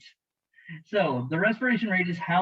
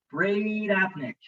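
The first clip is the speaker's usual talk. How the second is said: slow, drawn-out, higher word for a class to join; say-it-with-me teaching; 4 semitones higher, much slower than usual. Shown as a demonstration. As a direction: lead the class through it slowly. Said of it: "Say it with me".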